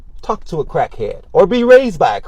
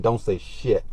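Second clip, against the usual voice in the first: low hushed warning; 7 semitones lower, 12 dB quieter, slower than usual. Retold or imitated. Hushed warning to her fiance on the street. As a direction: drop the voice, hard and quiet. Telling fiance to stay quiet.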